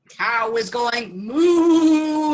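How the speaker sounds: a long, loud, slow moo; cow mooing. Acted. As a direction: stretch out the cow's moo.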